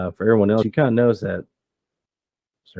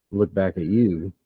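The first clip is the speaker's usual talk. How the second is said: normal talking voice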